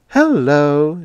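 bright chirped greeting; chirpy hello. A narrator's reading. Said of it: "she chirped".

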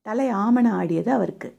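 plain reading voice

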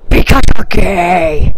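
loud, slow yell; loud, slowed-down taunt; shouted insult. Real, not acted. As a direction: yell it at the screen, dragged out. Yelling insult at chatbot.

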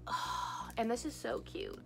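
breathy whisper of delight; soft whispered gush. Real, not acted. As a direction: hush it like a secret. Whispered aside over new toy.

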